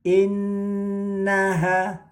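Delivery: innaha with nn held three beats, slow; held doubled n. Shown as a demonstration. Teacher demonstrating tasdid to students, nn held long. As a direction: sustain the nn of innaha, high and slow. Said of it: "ditahan ya, ditahan tiga harokat".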